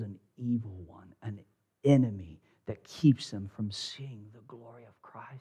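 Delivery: a hushed whisper about the enemy; whispered warning. Real, not acted. Preacher warning the congregation about the enemy, whispering. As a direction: lean in and whisper it.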